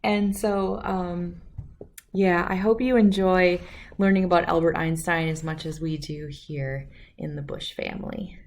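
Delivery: ordinary delivery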